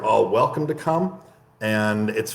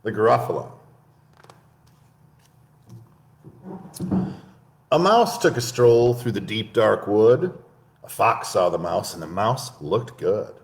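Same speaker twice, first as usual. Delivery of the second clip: measured bedtime-story read; storybook narration. Acted. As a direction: set the scene for little listeners.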